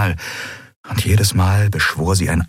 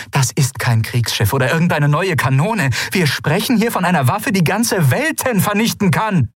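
furious; 10 semitones higher than usual. Acted. Ruler furious at ministers.

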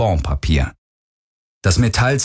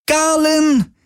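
distant scream. Acted. Kirai screams from afar.

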